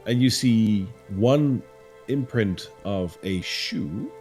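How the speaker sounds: slow, hushed storyteller voice; eerie narration. A narrator's reading. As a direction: paint the spooky scene slowly. GM describes eerie clue.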